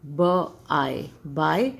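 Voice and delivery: बाय drawn out very slowly; slow model word. Shown as a demonstration. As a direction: stretch बाय for learners. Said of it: "next B Y E". (बाय धीरे और खींचकर)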